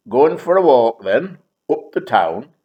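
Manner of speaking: loud, casual Liverpool invitation; a hint of Scouse, breezy; lightly done Liverpool accent. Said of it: "this one was from Liverpool".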